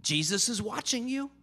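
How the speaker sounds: high, sing-out voice from the dark; mysterious voice. Acted. Unseen voice warning a burglar in the dark, high and eerie. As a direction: pitch it up as an unseen voice. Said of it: "he hears this voice and it says this".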